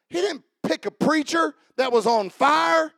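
loud, high-pitched revival preacher; full-force preaching. Real, not acted. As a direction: shout it out to rouse the congregation.

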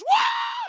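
a sudden, very high whoop breaking out; sudden holy whoop. Retold or imitated. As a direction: blurt it like a woman seized by the Spirit. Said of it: "some lady goes".

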